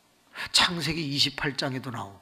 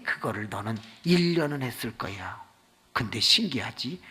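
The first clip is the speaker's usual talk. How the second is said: usual speaking voice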